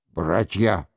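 ordinary delivery, nothing marked

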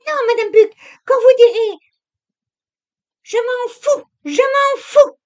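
high, loud, defiant yelling; shrieking at the maid, fed up; furious shout. A narrator's reading. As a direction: fling it at the maid in a rage. Camille quarrels with Madame Buck.

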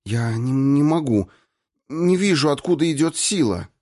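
high-pitched, halting admission of helplessness; stumble, then plead helplessly in a high voice; despairing. A narrator's reading. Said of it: "Она в отчаянии уронила руки". (в отчаянии, высоким растерянным голосом)

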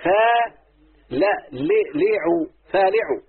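long فا, then لي، ليعو built up into فالعو; syllable by syllable. Shown as a demonstration. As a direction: sound out فالعو in pieces, then join it. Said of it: "يبقى على وزن ايه؟". (تقطيع فالعو إلى مقاطع ثم وصلها)

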